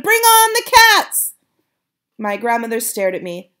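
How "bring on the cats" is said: slow, very loud high rallying cry; triumphant shout. Acted. Boy rallies grandmother against witches, slow loud shout. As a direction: drag it out like a battle cry. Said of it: "I shouted".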